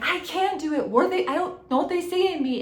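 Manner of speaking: a hammed-up retold meltdown cry; retold scream. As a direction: imitate a teen in a meltdown, yelling. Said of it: "you were screaming".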